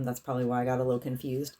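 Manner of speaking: ordinary talk, nothing marked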